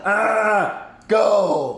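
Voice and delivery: reenacted impatient command; exasperated yell. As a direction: cut off whiners with a shouted order. Cutting off complainers.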